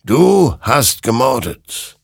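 slow, loud, high judgement; weighty, ringing condemnation; stern accusation. A narrator's reading. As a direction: pronounce guilt, drawing out each word. Creature accuses Hector of murder.

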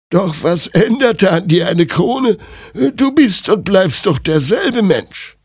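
put-on high old granny voice; admonishing old woman. Old woman questions the princess's wish, high elderly voice.

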